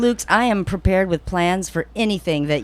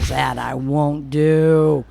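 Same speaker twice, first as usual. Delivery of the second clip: very slow, drawn-out no; slow firm refusal; 3 semitones lower, much slower than usual. Pretend. Crying parker refusing to apologise, slow and stubborn. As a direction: stretch each word out stubbornly.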